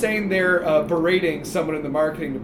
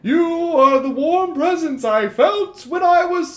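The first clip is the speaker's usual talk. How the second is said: nervous ghost; 9 semitones higher, 4 dB louder than usual. Acted. Ghost [name] voiced.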